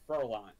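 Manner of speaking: "Furlan" said clearly, ending like "line"; surname pronunciation. Shown as a demonstration. Driver tells interviewer how to say his last name, demonstrated pronunciation of "Furlan" as fur-LINE. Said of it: "give me a good pronunciation".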